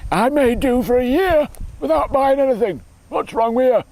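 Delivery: high-pitched, hammed-up comic complaint; funny voice. Shown as a demonstration. Middle-aged dad showing off his funny voice, comically indignant. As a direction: put on a silly high voice and protest. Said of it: "middle-aged men always put on funny voices".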